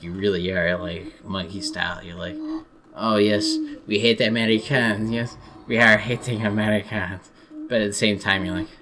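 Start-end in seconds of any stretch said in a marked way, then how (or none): 3.0-7.3 hammed-up French accent; mock French accent. French people sneer about Americans, mock French accent. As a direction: imitate a haughty French speaker, accent laid on. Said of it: "I'm doing some joking imitations".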